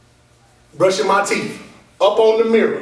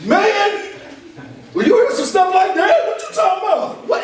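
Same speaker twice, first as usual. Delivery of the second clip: amazed shout; 11 semitones higher than usual. Real, not acted. Speaker telling students his son's answer, amazed shout. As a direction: burst out in amazed disbelief.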